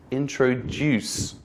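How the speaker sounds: "introduce" stretched slowly, stressing final DUCE; stress at the end. Shown as a demonstration. Teacher showing how it sounds, slow end-stressed "introduce". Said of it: "So it sounds like this".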